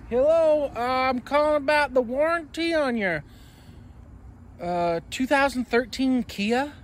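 telemarketer voice. Retold or imitated. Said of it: "Guy's like".

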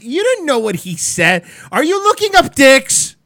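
incredulous outburst. Retold or imitated. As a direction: blurt it out in shocked mock disbelief at dad. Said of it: "And I was like".

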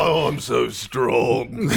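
very slow, low boxer boast; mock Mike Tyson. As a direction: drag out the brag like a slow boxer. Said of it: "Mike Tyson?".

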